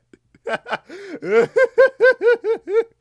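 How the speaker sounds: very high, loud fit of laughter; squealing cackle; shrieking laugh. Real, not acted. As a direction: lose it completely. Laughing at own ad bit.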